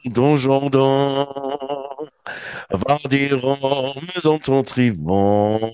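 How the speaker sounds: distorted, clipping microphone voice. Real, not acted. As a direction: voice distorting into the mic. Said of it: "deine Stimme verzerrt sich so, wenn du so reinsingst".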